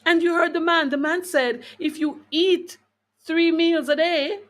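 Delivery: ordinary talk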